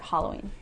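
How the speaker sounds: "Halloween" as HAH-loh-ween; rival pronunciation. Shown as a demonstration. Claiming online proof, "Halloween" with AH. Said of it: "it is pronounced".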